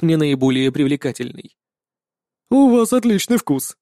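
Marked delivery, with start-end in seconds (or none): 2.5-3.8 gurgling alien. A narrator's reading. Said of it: "пробулькал продавец".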